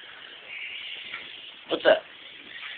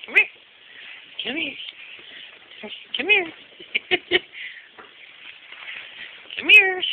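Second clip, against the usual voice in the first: calling the pet; 12 semitones higher, 4 dB quieter than usual. Pretend. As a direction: squeak come here up high. Calling the cat.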